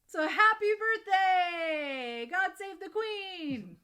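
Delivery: drawn-out, high, loud cheer; celebratory shout. Real, not acted. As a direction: proclaim it to the whole kingdom. Hailing the Queen's birthday.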